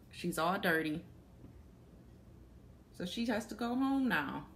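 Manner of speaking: ordinary delivery, nothing marked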